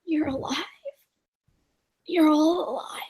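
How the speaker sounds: hushed, raspy whisper; whispery rasp of disbelief. Acted. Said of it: "voice is kinda raspy".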